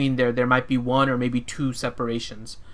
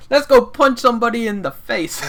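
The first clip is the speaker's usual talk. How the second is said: Russell Crowe impression; 8 semitones higher, 3 dB louder than usual. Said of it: "sounded like Russell Crowe".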